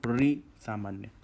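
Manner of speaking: ordinary talk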